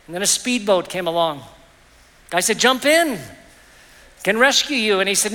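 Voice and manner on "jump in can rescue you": calling out. Acted. Speedboat rescuer calls out.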